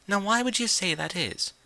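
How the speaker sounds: lightly voiced refined unicorn; Rarity's voice. A narrator's reading. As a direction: play the prim fashionista gently.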